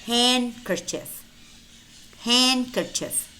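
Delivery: handkerchief as "hankerchief"; silent D. Shown as a demonstration. Teacher models silent letters for learners, silent D in handkerchief. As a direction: drop the D in handkerchief. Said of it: "but it's silent".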